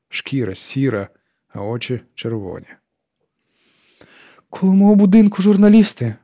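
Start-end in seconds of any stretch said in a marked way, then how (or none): 4.5-6.2 високо, тонко й голосно; сваритися тоненьким голоском; тоненький сварливий голос. A narrator's reading. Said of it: "тоненьким, сварливим голосом". (тоненьким, сварливим голосом)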